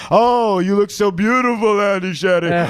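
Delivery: lightly voiced Sicilian flatterer; a hint of a Sicilian crooner; Sicilian charmer. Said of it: "He does this whole Sicilian thing".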